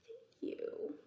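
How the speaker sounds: breathy shy whispered thanks. Acted. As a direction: whisper it bashfully. Shy bug thanks softly.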